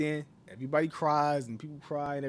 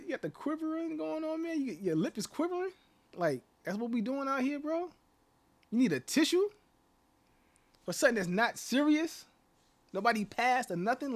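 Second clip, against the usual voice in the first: higher, incredulous mock questions to a crier; mocking disbelief; 10 semitones higher than usual. Ranting vlogger mocks a grown man crying over nothing, incredulous. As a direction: needle him, rising in disbelief.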